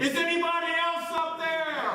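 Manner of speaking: high, loud call upward; yelling. Acted. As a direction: yell up for another rescuer. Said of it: "and then he yelled".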